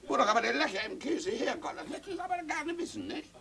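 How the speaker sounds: put-on high, pleading little boy; a child's high voice pleading with papa. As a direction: beg papa for the answer.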